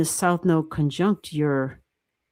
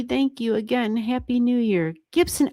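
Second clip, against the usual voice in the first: normal talking voice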